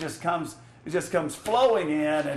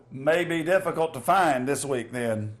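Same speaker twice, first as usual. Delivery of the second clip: ordinary delivery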